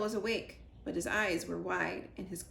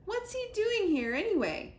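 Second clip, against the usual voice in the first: high exasperated hen; 12 semitones higher, 5 dB louder than usual. A narrator's reading. Moosh asks about squirrel.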